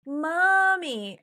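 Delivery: put-on high, loud wailing child voice; crying child. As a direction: imitate a little girl calling through tears. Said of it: "she's like".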